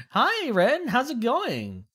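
high, loud singsong hello; cheerful greeting. Real, not acted. Streamer welcoming Ren who just arrived, cheerful. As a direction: greet them with a lifted, bouncy voice.

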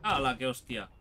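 ordinary talk

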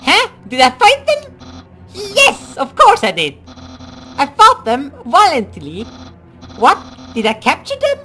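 high, loud cartoon voice with swooping pitch; anxious, eager underling. Underling answers Undyne about the human, high and pleading. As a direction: plead and protest in a squeaky, overblown voice.

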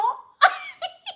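high-pitched helpless giggle. Real, not acted. Still laughing at the subscriber's crush, high giggle.

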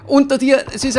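high, loud frantic warning; panicking gamer. Real, not acted. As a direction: yell urgently to save your teammate. Warning teammate of enemy.

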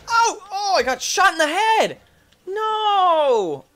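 high, loud wail of defeat; distressed outcry. Real, not acted. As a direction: cry out in dismay, long drawn "no". Player gets shot in game.

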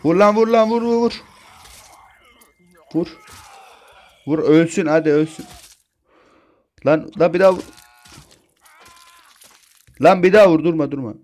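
high, loud urgent yells; frantic shouting. Real, not acted. Gamer yelling at the girl to strike.

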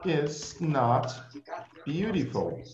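She is not beautiful stretched out very slowly; teacher slowly modelling the answer; very slow model. Shown as a demonstration. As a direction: draw out each word for the class. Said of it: "Very good".

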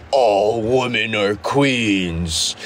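lightly voiced sister, slow and low; sister's voice. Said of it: "I just hear my sister's voice".

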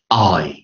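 I as front "ah" gliding to a short "ee"; American-style drawn-out "eye" for I; "ah" plus short "ee". Shown as a demonstration. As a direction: open wide on I, then close briefly to "ee". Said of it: "再念短义音".

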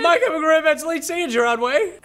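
high, loud laughing explanation. Real, not acted. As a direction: still cracking up as you explain it.

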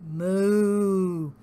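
long drawn-out cow moo. A narrator's reading. Storyteller as the cow, mooing long. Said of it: "said the cow".

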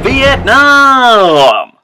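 high, long-held holler, dropping at the end; shouted greeting. Acted. Host opens his episode with a drawn-out high shout. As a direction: belt it out as a drawn-out wake-up call. Shouted show-opening catchphrase.

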